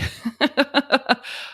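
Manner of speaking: laugh. Real, not acted. Laughs after her tirade.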